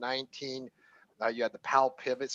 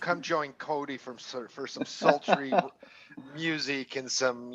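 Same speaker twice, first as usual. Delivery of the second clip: sultry late night radio host; 5 dB quieter, slower than usual. Retold or imitated. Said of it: "hosting a podcast at night".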